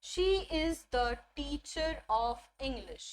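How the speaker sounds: very slow, each word laid out; slow teacher demo of a full sentence; slow model sentence. Shown as a demonstration. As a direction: read it like a model for the class. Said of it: "best example मैं आपको बताती हूँ".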